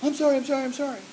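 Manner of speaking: high-pitched imitation of a whimpering apology; mock whining. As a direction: whine it like a cornered little brother. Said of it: "as he was crying and whining".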